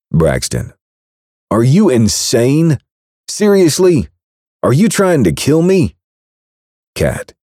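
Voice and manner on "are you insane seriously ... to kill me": yelling at her in shock; furious outrage. Acted. Braxton to the baker who drugged him, furious outrage.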